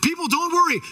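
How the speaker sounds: high, projected show of confidence; public reassurance. Acted. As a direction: call it out to a crowd you must settle. Moses acting confident before the people.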